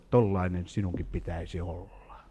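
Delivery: a quiet inner voice speaking; preacher quoting what God told him, softly; God's gentle word. Retold or imitated. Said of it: "Jumala sanoi minulle".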